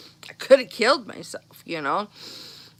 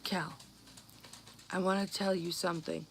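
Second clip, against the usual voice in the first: hushed serious; 2 semitones lower, 13 dB quieter than usual. Retold or imitated. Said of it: "He was dead serious".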